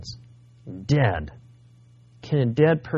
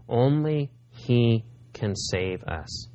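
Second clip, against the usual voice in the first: ordinary delivery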